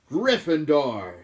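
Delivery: hat booms Gryffindor; hat shouting. Acted. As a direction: stretch out the house name to the hall. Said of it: "shouted the hat".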